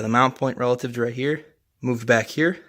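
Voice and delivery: normal talking voice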